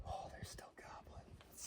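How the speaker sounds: soft excited whisper; whisper, delighted; hushed whisper. Real, not acted. Whispering near gobbling turkeys.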